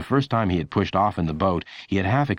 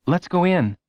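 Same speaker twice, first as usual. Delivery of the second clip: put-on higher, eager boy voice; Lenny's voice; 10 semitones higher than usual. A narrator's reading. Lenny inviting Friend into the house, eager. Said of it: "Lenny would say".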